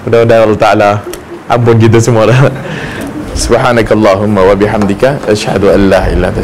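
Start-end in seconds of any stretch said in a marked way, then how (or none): 3.3-6.4 low, soft recitation fading to a whisper; closing prayer. Real, not acted. Preacher closes the talk with a soft, low closing prayer. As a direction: murmur the closing dua, dropping away.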